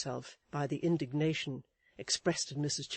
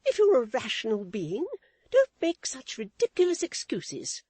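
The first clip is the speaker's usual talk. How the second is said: much higher, prim lady's voice; Missus Chick's voice; 9 semitones higher, 8 dB louder than usual. A narrator's reading. Wife lecturing her husband, in a high prim voice. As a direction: preach a lesson, high and proper.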